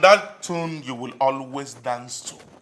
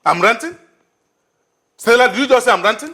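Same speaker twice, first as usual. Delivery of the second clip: higher and louder, offended; indignant disbelief; 8 semitones higher, 5 dB louder than usual. Acted. Man indignantly repeating her accusation to her, higher and louder. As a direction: throw her word back in disbelief.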